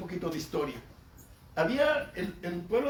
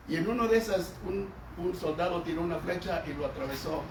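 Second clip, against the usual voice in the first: ordinary talk, nothing marked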